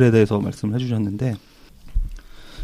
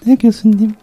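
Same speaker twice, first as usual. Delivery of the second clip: high, bright nurse reply; chirpy head nurse answering; 11 semitones higher, 5 dB louder, slower than usual. Acted. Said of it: "수간호사 역할 하겠습니다".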